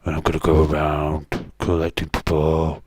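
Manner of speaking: Batman voice. Retold or imitated. Streamer showing chat how Batman talks, low and loud. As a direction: drop low and announce it like the Dark Knight. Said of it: "and he talks like this".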